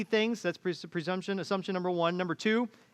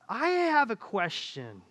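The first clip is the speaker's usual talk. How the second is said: put-on higher, slow child's voice; daughter's voice; 4 semitones higher, slower than usual. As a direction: draw it out like a kid taking her time. Daughter announcing her question.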